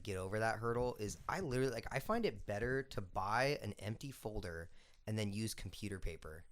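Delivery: ordinary delivery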